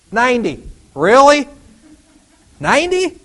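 high, loud disbelief; can't believe it; incredulous Sarah. Acted. Sarah doubting God's promise, high loud disbelief.

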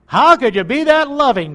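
loud, high amazed question; wondering believer, loud and high. Retold or imitated. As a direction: marvel at it aloud. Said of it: "you've wondered".

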